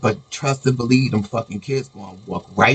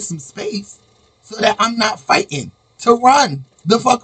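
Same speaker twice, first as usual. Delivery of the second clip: exasperated rant; 10 semitones higher, 3 dB louder than usual. Real, not acted. Venting, building exasperation.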